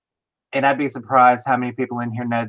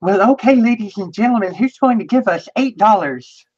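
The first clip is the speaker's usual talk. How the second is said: much higher, put-on showy auctioneer voice; Carol Channing; 8 semitones higher, 3 dB louder than usual. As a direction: announce it high in a theatrical star impression. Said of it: "Carol Channing's voice".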